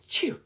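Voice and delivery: sharp mock sneeze; a quick comic sneeze.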